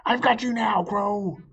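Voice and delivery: loud, high triumphant cry; triumphant shout. Acted. Fox traps Crow at last, loud triumph. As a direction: crow over the catch.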